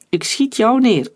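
confident threat, pitched high; self-assured. A narrator's reading. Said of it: "klonk Omer zelfverzekerd".

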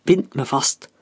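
ordinary delivery, nothing marked

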